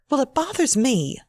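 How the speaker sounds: sharp snap pitched high; snapped. A narrator's reading. Wife snapping back at her husband, high and sharp. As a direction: bite the words off at her husband. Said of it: "Dina snapped".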